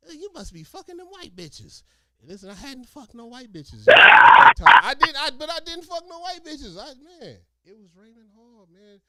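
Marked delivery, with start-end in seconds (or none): 0.1-1.8 high accusing mom voice; scolding mom voice. Retold or imitated. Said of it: "She's like". 2.2-7.4 loud protesting yell; indignant shout. Real, not acted. Protesting against mom's accusation.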